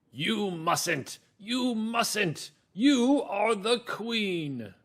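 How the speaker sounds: high, loud storybook gardener, theatrically scandalized; gardener's protest. Acted. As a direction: exclaim it high, stretching the final words. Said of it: "here's the gardener now".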